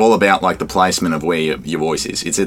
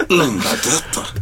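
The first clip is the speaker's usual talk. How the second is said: slow, high mock French lisp; French Sid; 6 semitones higher, slower than usual. Repeating the French Sid line slowly. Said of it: "French Sid from Ice Age".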